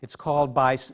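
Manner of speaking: ordinary talk, nothing marked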